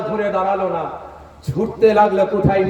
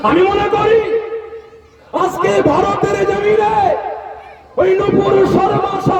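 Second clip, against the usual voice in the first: chanting crowd-rousing sermon shout; fiery preaching. Acted. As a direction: rouse the crowd from the stage. Fiery sermon against insulters.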